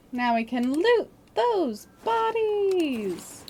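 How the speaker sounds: put-on high, slow, sing-song kids' show host; chirpy cartoon explorer calling out to viewers; Dora the Explorer voice. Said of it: "in the Dora, Dora the Explorer voice".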